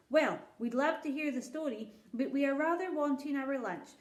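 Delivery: a king asking kindly but distracted; lightly voiced king. King impatient for lunch.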